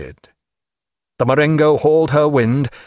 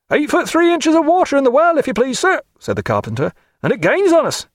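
much higher, strained report of the leak; worried carpenter; 14 semitones higher than usual. Acted. The carpenter reports the flooding well to Jack, high and urgent. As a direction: sound the alarm about the water rising.

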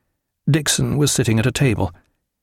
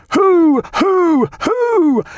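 very high, loud, sustained hoots; bellowed hoots; 5 dB louder, much slower than usual. A narrator's reading. Sailors jeering at the cutter, bellowing. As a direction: bellow each hoot on one high note. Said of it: "they all bellowed".

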